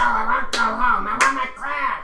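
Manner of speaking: high, loud goblin whine; whiny goblin. Acted. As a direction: whine to go home. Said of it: "I love your Goblin voice".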